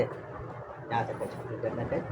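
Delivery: quiet, slow coaching; soft guiding. Real, not acted. Father guiding his son's pencil stroke, soft and slow. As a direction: talk him gently through the stroke.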